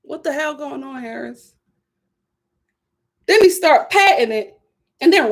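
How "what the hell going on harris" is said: stunned mutter. Real, not acted. Shocked at the kiss.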